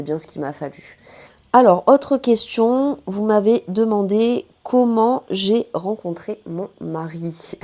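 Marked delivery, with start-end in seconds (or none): 1.5-7.6 high, loud, slow read-out dropping on the last word; drawn-out presenter delivery; announcing a question. Real, not acted. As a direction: announce it slowly in a raised voice, then let the end fall. Reads out a viewer question.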